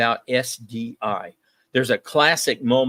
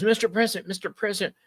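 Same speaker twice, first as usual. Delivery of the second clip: frantic calling after; 9 semitones higher, much faster than usual. Retold or imitated. Gorbachev chasing after departing Reagan.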